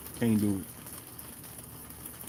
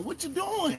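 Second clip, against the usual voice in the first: exasperated question; 14 semitones higher, 5 dB quieter than usual. Real, not acted. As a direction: voice way up, incredulous. Scolding the stunting defense.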